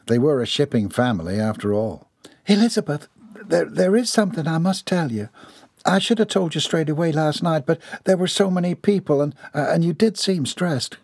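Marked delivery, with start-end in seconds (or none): 2.5-11.0 higher, hesitant Southern lady. Acted. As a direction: work up to a confession. Voices lady in story.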